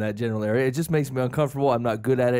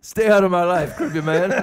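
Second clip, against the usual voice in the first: playfully huffy, louder; mock rebuff; 5 semitones higher, 5 dB louder than usual. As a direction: shoo him off in jest.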